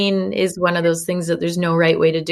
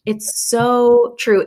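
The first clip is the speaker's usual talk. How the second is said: ordinary talk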